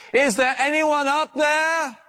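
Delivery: high, slow shout upward; desperate shout. Acted. Dangling man shouts up to heaven for anyone, pleading. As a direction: call up into the sky, pleading. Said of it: "looking up, he said".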